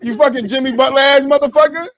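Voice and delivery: loud playful insult; mock angry. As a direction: trash talk him, laughing inside.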